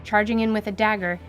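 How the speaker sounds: ordinary talk, nothing marked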